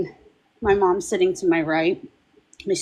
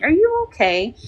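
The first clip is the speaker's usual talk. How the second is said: higher-pitched, concerned check-in; concerned question; 7 semitones higher than usual. Retold or imitated. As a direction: retell a worried question, pitched up. Worried daughter asks her ailing mom.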